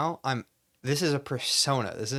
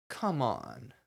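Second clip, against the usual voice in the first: bad actor monologue; 6 dB quieter than usual. Shown as a demonstration. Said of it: "how would a bad actor do this?".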